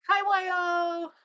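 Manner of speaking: high, loud, slow cooing greeting; excited. Real, not acted. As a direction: greet the bird with delight. Greeting a pet bird.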